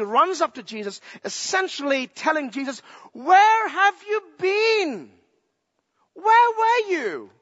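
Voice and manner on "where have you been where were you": high, slow and louder, pleading where were you; a grieving sister's cry of disappointment; anguished reproach. Acted. Sister Martha reproaching Jesus for coming late, pleading and anguished.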